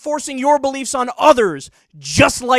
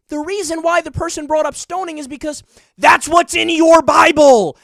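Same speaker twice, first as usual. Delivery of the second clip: real anger, higher and much louder; angry shout; 6 semitones higher, 7 dB louder than usual. Real, not acted. Ranting at Bible-believing critics, angry loud shout. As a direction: shout it at the viewers. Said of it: "I don't know why I'm getting so angry".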